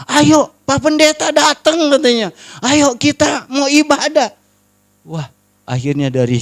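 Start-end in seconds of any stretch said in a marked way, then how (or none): 0.1-4.3 high-pitched eager rallying call; excited invitation. Retold or imitated. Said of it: "Karena mereka kaget". (mengajak dengan semangat, nada tinggi)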